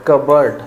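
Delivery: कबर्ड said continuously, with no p sound; कबर्ड with a reduced ending, not "board"; run-together pronunciation. Shown as a demonstration. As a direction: say कबर्ड as one word, "kuh-berd". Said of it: "continue बोला जाता है".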